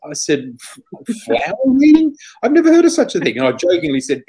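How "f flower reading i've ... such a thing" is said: much higher and louder in disbelief; gobsmacked by the very idea; astonished. Retold or imitated. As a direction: cry out in stunned surprise. Shocked at flower reading idea.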